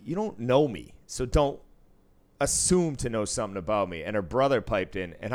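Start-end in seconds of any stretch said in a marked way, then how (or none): 0.0-4.0 louder firm warning; retold confrontation. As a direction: set a stranger straight, firmly. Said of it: "I was like".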